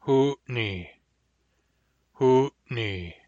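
hard, glottalized n in "Hootney". Shown as a demonstration. Teacher demonstrating hard N to learners, glottalized "Hootney". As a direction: say "Hootney" with a catch in the throat before the n. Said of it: "Hard N".

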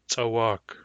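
short first, long stressed second syllable in "Tsawak". Shown as a demonstration. Teacher showing vowel length to learners, "Tsawak" stressed on its long second syllable. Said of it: "it is stressed on the second syllable".

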